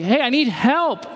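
hint of a needy child calling out; lightly voiced crying baby. Preacher voicing neglected children calling caregivers, lightly pleading. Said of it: "You're those crying babies".

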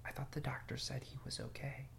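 worried hushed whisper, unvoiced. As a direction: whisper so the boy can't hear. Worried parent whispering to spouse.

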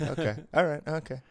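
high-pitched sceptical okay; mock acceptance. Reacting to Montreal's East Coast claim, high mock acceptance.

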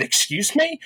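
high, rising indignant question; affronted disbelief. Retold or imitated. Agent answering a caller's insult, affronted disbelief. Retells reaction to being called asshole.

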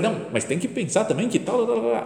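high, breathless hammed-up retort; imitating herself arguing back; mock rebuttal. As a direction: toss the "tal, tal" back mockingly. Woman retells her own rebuttal.

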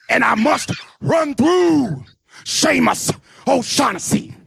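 slow, stretched revival-style cadence; hammed-up sermon delivery; mock preacher. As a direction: preach it, drawing out key words.

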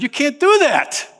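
high, loud alarmed cry; Martin, shocked, crying out to Mickey; shocked protest. Retold or imitated. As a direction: blurt it in disbelief.

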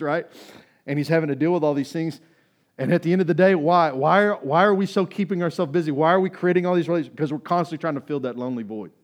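impassioned preaching. Acted. Preacher to church about filling the lonely void, impassioned. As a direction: push it out loud and urgent.

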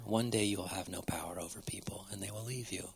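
hushed, grave warning, nearly a whisper. Real, not acted. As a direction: drop to a whisper to warn them. Solemn warning to the mockers.

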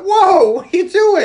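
very high, loud mock alarm; hammed-up panicked objection; alarmed shout. Boy sees Terminator about to shoot, hammed-up alarmed shout.

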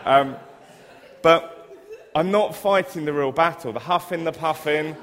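loud, high, laughing through the words. Real, not acted. Preacher recovering from laughter before the congregation. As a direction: still cracking up as you speak.

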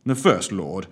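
plain reading voice

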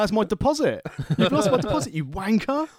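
high, loud, laughing outrage; angry rant. Retold or imitated. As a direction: shriek about the lost deposit. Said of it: "and Abby's like".

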